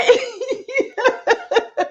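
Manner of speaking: long high cackle; real laughter. Real, not acted. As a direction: crack up.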